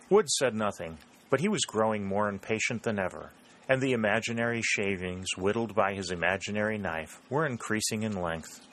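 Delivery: usual speaking voice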